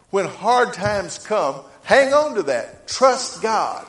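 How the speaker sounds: full-force pulpit exhortation; preaching. Acted. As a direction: build to a rousing plea.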